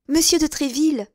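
startled recognition; exclaimed. A narrator's reading. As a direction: exclaim the name in amazement. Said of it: "s'écria la novice".

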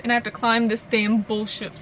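usual speaking voice

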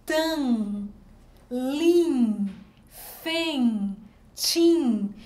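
"Tan", "lin", "fen", "tim" separados, cada sílaba nasal bem marcada; sílabas nasais isoladas. Shown as a demonstration. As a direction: solte cada sílaba nasal sozinha, com pausa entre elas. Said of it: "eles têm uma função de tio". (sílabas nasais ditas uma a uma, com pausas)

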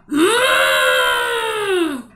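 loud trumpeting hum; loud elephant call through the nose. Acted. Elephant noise in children's story.

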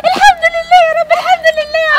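high, loud praise to God; joyful thanks. Acted. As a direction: cry out thanks in relief and joy. Mother thanks God, overjoyed.

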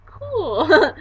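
high, loud, hammed-up approval; imitated enthusiasm. Dad responding to daughter's news, imitated enthusiasm. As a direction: mimic an impressed dad. Dad's reaction, acted out.

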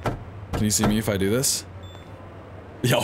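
usual speaking voice

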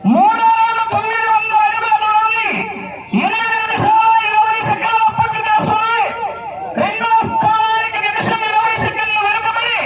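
high, sustained one-note announcing chant; race announcer. Real, not acted. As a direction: call the race standings on one high pitch. Live race announcement over speakers. (పందెం వ్యాఖ్యాత హెచ్చు స్వరం)

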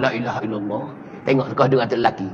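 resigned low mutter; weary murmur. Real, not acted. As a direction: sigh it out under the breath. Exasperated sigh of piety.

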